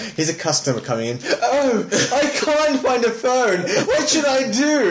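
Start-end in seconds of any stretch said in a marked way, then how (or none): 1.4-4.9 high, slow, exaggerated posh accent; hammed-up posh helplessness, drawn out. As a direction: put on a posh, high-pitched customer voice. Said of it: "always switches to his posh accent".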